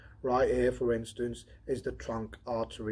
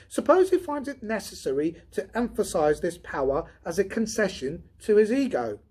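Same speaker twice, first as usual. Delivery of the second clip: higher, louder voice for the redhead Donovan; Donovan voiced higher; 9 semitones higher, 10 dB louder than usual. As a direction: lift into the tired redhead's voice.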